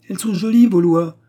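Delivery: high, loud teasing scorn; mock his laws playfully; mischievous mockery. A narrator's reading. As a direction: impish jab at the officer. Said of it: "d'un air espiègle".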